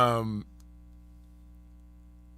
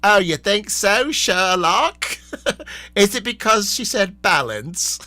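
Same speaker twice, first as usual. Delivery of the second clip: higher, louder, slow mock-sarcastic jab; sarcastic Alan; 8 semitones higher, 7 dB louder, slower than usual. Alan mocking Kate's obvious guess, loud, slow and sarcastic. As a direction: drag it out with teasing sarcasm. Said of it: "and Alan is like".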